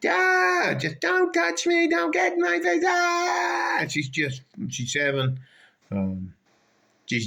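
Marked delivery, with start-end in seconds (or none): 0.0-3.8 screaming lady. Retold or imitated. Retelling a lady screaming at the guard, high shrieking. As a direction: shriek like a woman losing it. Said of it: "you just hear some lady just screaming".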